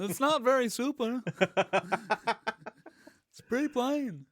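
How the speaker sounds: high, whiny stand-up bit; Jerry Seinfeld. Acted. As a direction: puzzle over it like an observational comic. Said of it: "that's a Jerry Seinfeld joke".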